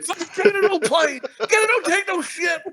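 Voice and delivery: high, hammed-up tough-guy rant; mock angry. Gator persona comes out of him, mock angry. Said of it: "And he just gets angry".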